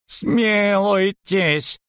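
very drawn-out, high plea; high-pitched, desperate creature cry; heart-rending wail. A narrator's reading. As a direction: wail for mercy, stretching the word. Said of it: "душераздирающе завопил Доплер".

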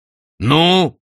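impatient prompting. A narrator's reading. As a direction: hurry the other speaker along. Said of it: "поторопил своего собеседника правитель демонов". (нетерпеливо, громко и выше)

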